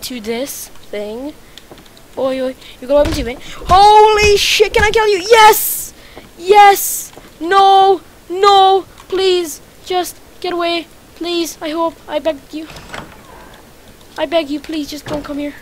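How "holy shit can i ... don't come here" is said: high, loud frantic begging; frantic pleading. Real, not acted. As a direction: beg the enemy to back off in a panic. Frantic fight for his life.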